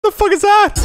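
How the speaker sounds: normal talking voice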